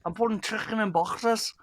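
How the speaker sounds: exaggerated Scouse, high and drawn out; forced Scouse accent. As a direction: stretch it out in a cartoonish Scouse accent. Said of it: "it really forces that Scouse accent".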